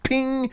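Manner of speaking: sudden high-pitched "ping"; high pinging noise. Retold or imitated. Actor mimicking his throat giving out, high ping. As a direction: imitate elastic snapping with a high ping. Imitates something snapping in throat.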